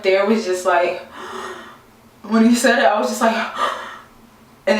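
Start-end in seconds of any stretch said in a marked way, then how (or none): 1.1-1.5 sharp shocked intake of breath; short shocked gasp. Retold or imitated. Reacting to what he said. 3.4-3.9 sharp shocked intake of breath; short shocked gasp. Retold or imitated. Reacting to what he said.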